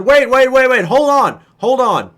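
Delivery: loud, high "wait, wait"; calling out to stop. Retold or imitated. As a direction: halt the whole room. Stopping everyone in the room.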